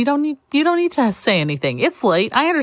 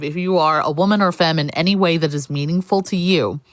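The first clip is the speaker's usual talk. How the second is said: ordinary talk, nothing marked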